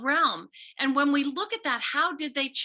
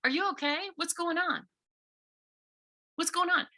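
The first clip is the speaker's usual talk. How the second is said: caring check-in; 4 semitones higher than usual. Pretend. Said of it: "you simply say".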